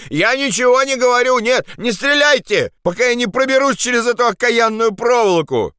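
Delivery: high-pitched pleading shout. Acted. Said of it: "крикнул он". (отчаянный крик с мольбой)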